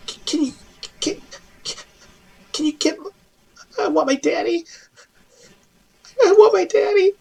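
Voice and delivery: put-on very high, small, broken-up child's plea; a scared child begging through tears for her daddy; tearful little girl. Rescued halfling girl begs the player for her daddy, tearful and very high.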